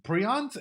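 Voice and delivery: "Prions" said as PREE-ons, rising questioningly; pronounced "pree-ons". Shown as a demonstration. Streamer doubting own pronunciation of a word, "Prions" as PREE-ons. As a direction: say "Prions" with a long ee in the first syllable. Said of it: "is it pronounced prions?".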